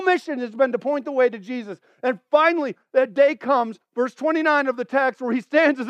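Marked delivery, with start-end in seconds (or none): none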